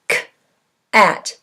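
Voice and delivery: "K", pause, "at"; "K" as a lone consonant, then "at" after a gap; onset and rime apart. Shown as a demonstration. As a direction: split cat into the bare "K" sound and "at". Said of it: "What's the first sound in cat".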